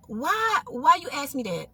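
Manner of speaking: soft, taken-aback question; quiet, halting, wary retelling; stunned. Retold or imitated. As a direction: ask it hushed, as if spooked. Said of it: "she looked like she had saw a ghost".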